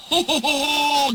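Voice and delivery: belly-laugh it out, high; guffawing. Acted. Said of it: "guffawed the trucks".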